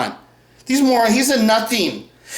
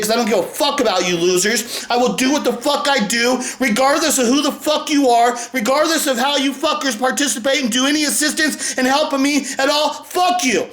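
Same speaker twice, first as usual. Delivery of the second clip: ordinary talk, nothing marked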